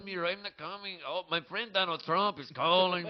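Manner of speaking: put-on high comic accented voice; acted underling. Nikolai refusing Vladimir's summons, high accented character voice.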